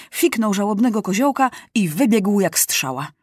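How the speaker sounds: plain reading voice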